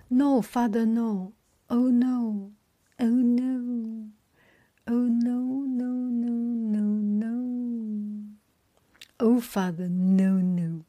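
acted lament, stretching each "no"; distressed pleading.

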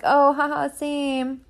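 high, breezy "oh haha same"; mock casual agreement. Narrator replaying her breezy reply to a friend. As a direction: toss it off lightly, hiding the feeling. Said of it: "And I was like".

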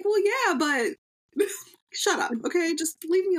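high-pitched conceding husband quote; mock husband. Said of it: "He's like".

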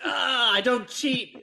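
high, slow whiny voice; whiny denial. Retold or imitated. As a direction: whine it like a caught cheater. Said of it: "He's like".

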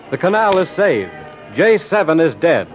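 ordinary talk, nothing marked

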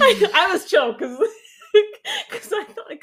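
talking through laughter; laughing. Real, not acted. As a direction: giggle while speaking.

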